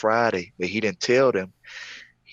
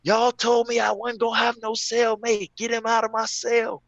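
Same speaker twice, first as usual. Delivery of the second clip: screaming inmate; 14 semitones higher, 6 dB louder than usual. Retold or imitated. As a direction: scream it like a furious cellmate. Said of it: "I heard him screaming".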